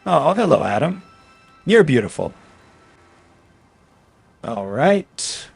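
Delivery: normal talking voice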